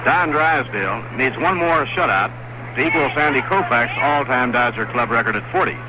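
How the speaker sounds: ordinary talk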